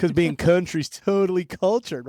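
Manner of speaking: high, loud mock-boast, stretched out; kidding sarcasm. Teasing the co-host about country culture, mock-sincere. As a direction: overplay the sarcasm for a joke. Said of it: "Obviously, just kidding".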